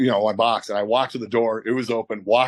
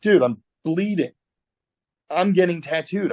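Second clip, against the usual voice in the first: higher-pitched exasperated protest; retold protest; 7 semitones higher than usual. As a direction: plead your case in disbelief. Protests to threatening former boss.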